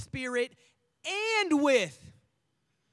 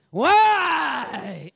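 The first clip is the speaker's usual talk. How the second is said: put-on loud, drawn-out child's wail; mock tantrum cry; whining kid; 12 dB louder than usual. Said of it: "y'all got a kid like that".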